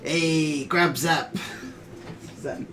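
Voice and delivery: cookbook character voice. Acted. Cook trying out the cookbook voice, put-on character accent. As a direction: put on the recipe writer's voice. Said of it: "I don't know if I have to do the voice".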